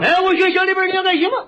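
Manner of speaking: high-pitched Shandong shopkeeper; imitated Shandong uncle; Shandong butcher. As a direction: ask the customer in thick Shandong dialect. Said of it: "我论着管他叫张大爷".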